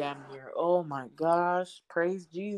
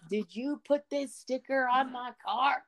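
higher, sharp accusing voice, imitating the neighbor; neighbor going off; 6 semitones higher than usual. Retelling how the neighbor accused her over the sticker, mock angry. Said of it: "She's going off".

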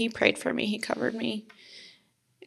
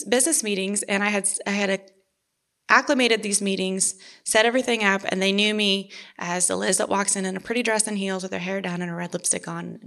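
usual speaking voice

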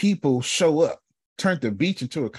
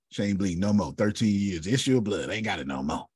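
usual speaking voice

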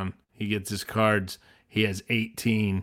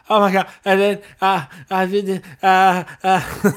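high, loud, flustered fangirl stammer; gushing, tongue-tied girl, pitched up loud; starstruck teenage girl; 8 semitones higher, 7 dB louder than usual. Retold or imitated. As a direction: stammer like a thirteen-year-old meeting an idol. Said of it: "was a thirteen-year-old girl".

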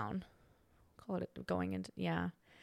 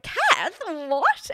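high, louder exclamation of disbelief; mock shock; 7 semitones higher, 4 dB louder than usual. Reacting to friend's radio gossip, mock shocked. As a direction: act scandalized by the gossip.

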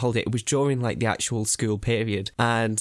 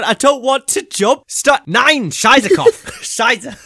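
much higher and louder panicky refusal; panicked yell; 12 semitones higher, 10 dB louder, much slower than usual. Retold or imitated. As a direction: shriek in mock panic, swearing in German. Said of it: "I was hanging on".

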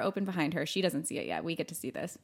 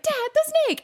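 very high, loud imitation of a panicked daughter; play-acted panic, shooting up in pitch; mock alarmed cry; 8 dB louder than usual. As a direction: squeal a warning to dad, hammed up. Retold girl alerting her dad.

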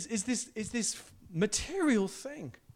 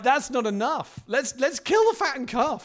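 louder and higher, bursting with joy; excited father; 5 semitones higher, 7 dB louder than usual. Retold or imitated. Father ordering the feast, excited and loud. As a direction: throw a party with your voice. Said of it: "And then he's like".

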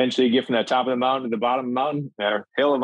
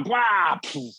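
imitated wipeout crash; 8 semitones higher than usual. Guest recounting a first ski fall, imitated crash noise. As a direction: act out a comic tumble in noises.

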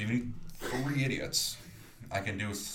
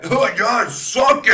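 loud, high taunt; mocking shout; 12 semitones higher, 12 dB louder, slower than usual. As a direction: yell it in triumph. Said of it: "mock taunt".

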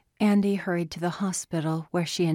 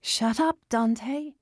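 shouting; 6 semitones higher, slower than usual. Acted. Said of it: "everyone shouted".

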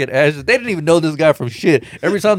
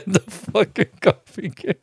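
higher-pitched, telling it through laughter; laughing; 6 semitones higher than usual. Real, not acted. Friend retelling a funny ash-scattering scene, laughing. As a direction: crack up as you tell it.

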